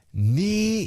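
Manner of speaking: high, stretched-out taunt; teasing high-pitched imitation. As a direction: draw the single word out high.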